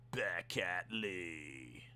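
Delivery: slow, low, reverent hush; softly stretched announcement; hushed drawn-out name. Acted. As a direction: savour the name quietly.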